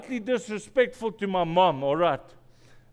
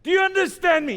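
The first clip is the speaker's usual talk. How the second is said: stern father; 11 semitones higher, 6 dB louder, faster than usual. Retold or imitated. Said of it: "He says".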